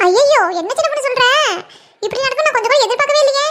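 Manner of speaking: taken aback, pitch lifted; dismayed surprise. Acted. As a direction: exclaim in shock at unexpected news. Reacting to bad shop news.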